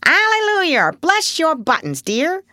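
high, loud parrot croak. A narrator's reading. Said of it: "gravely croaked".

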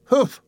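high, sharp offended humph; indignant snort. A narrator's reading. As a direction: snort in outrage. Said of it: "Aunt Dicey snorted".

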